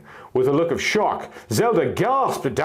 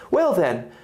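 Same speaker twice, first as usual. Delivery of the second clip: higher, courteous royal welcome; mannerful princess; 7 semitones higher than usual. Acted. Princess Zelda formally welcoming the griffin lord, gracious. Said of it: "Zelda said, mannerfully".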